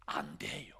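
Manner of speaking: breathy whispered no; hushed aside to the listeners. Shown as a demonstration. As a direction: drop to a secret whisper. Whispered answer to the congregation.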